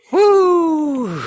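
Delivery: woo shout. Real, not acted. Excited exclamation.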